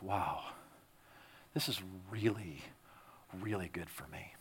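very soft, low recalled wonder; hushed realization. Retold or imitated. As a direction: let it drop low and quiet, as if realizing. Retells quiet sabbatical realization.

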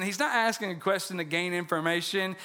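ordinary talk, nothing marked

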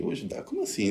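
light mock bafflement at the request; retold disbelief. Man recalling being asked to help a friend's daughter, in soft surprised disbelief.

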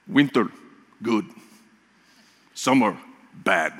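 terse imitation of a Russian accent; deadpan Russian-accented words with long pauses. Preacher quoting his nurse's verdict on Oklahoma, imitated Russian accent. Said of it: "Here's what she said".

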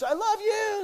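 high, loud fan shriek; screaming fan at a concert; concert yell. Retold or imitated. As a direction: yell it at the stage. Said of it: "people yell out at concerts".

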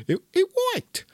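squeaky high voice of delight; gleeful squeak. Retold or imitated. Comic squealing that his pickup line worked. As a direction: squeal it like a giddy kid.

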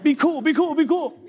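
high, nervous self-talk; mock panicked self-coaching. Preacher tells himself to calm down, high mock-nervous voice. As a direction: pitch up, urgently steadying yourself.

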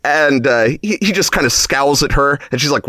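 ordinary talk

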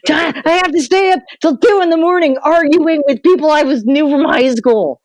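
high mock wail of despair; half-hearted fake distress; mock anguish. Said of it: "And then I'd be like".

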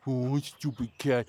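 usual speaking voice

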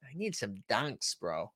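soft, slow Boston mumble; lazy Boston drawl; quiet Boston accent. Pretend. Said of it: "that accent was any of my neighbors".

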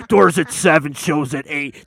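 booming monster truck announcer. Acted. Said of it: "That's my monster truck voice".

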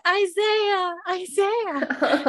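high, imploring mock cry; calling a lover. Young woman running into street, hammed-up calling out. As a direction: call the name high and long.

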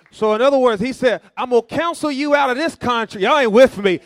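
high, loud shouted preaching; preaching at full force. Real, not acted. Preacher crying out to the church about the cross, shouting. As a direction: shout it out, building to the top.

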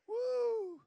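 loud, high "woo"; long, ringing cheer; excited whoop. Real, not acted. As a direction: let out a big high-pitched whoop. Preacher whoops after building up.

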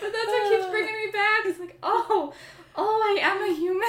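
high, loud laughing cry; laughing through tears. Real, not acted. Laughing while near tears.

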